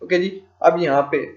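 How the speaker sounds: ordinary talk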